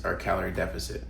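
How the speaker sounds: ordinary talk, nothing marked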